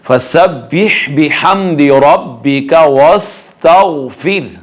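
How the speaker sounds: फसब्बिह slowly with audible breathed h; measured chant, sounding the h in फसब्बिह; clear haa recitation. Shown as a demonstration. Teacher reciting the whole verse, clear haa recitation. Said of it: "हा को भी वाज़े करना है".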